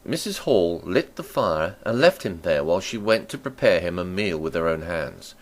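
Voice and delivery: ordinary delivery, nothing marked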